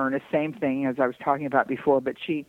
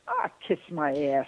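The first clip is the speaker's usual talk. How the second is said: mild Southern-Detroit aunt impression; Aunt Irma Dee's accent. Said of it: "I'd say".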